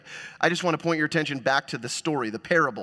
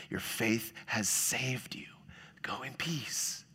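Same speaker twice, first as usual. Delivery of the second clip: slow whisper, much softer; whispered blessing; 3 semitones lower, 10 dB quieter, slower than usual. Retold or imitated. Preacher echoing Jesus's blessing to the woman in a slow whisper. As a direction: breathe it out gently.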